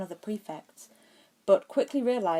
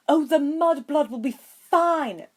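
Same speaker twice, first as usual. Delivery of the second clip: slow, higher, louder dismissive toss-off; flippant; 10 semitones higher, 10 dB louder, slower than usual. A narrator's reading. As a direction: brush it off as nothing. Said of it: "Draco started flippantly".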